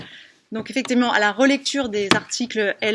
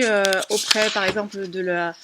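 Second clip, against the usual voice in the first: usual speaking voice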